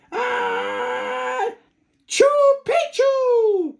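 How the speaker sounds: very high drawn-out sneeze of the word; sneezed password. Acted. Puppy sneezes password into the chief's ear, high and slow. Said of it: "half sneezed, half spoke".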